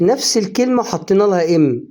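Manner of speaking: ordinary talk, nothing marked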